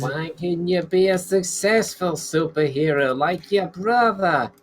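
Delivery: put-on high, slow, drawn-out New York accent; thick New York accent. GM demos the middle-aged neighbour, thick New York accent. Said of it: "his thick New York accent".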